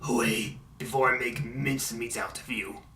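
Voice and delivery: low, quiet, evil intimidating villain voice; Doctor Eggman. Retold or imitated. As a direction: drop low and soft with menace. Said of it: "this evil, intimidating voice like".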